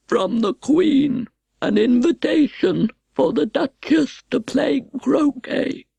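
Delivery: slow, formal footman voice; a stiff ceremonial announcement; solemn slow frog footman. Acted. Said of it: "in the same solemn tone".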